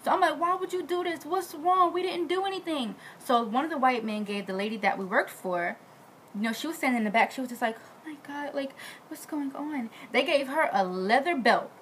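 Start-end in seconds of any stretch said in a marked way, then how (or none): 0.4-3.0 high-pitched retold plea; pleading protest. Maid questions the mob over her cousin, high pleading. Acted protest after the shooting. 7.8-9.9 soft, higher acted disbelief; stunned hush. Lady watching from the back, hushed shock.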